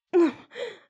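sudden high mocking laugh; loud taunting laugh. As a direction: laugh as the mask drops. Spy exposed, laughs.

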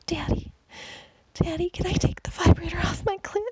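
squeal. A narrator's reading. She begs Daddy for permission, squealing high. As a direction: beg breathlessly high and urgent. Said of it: "I squeal".

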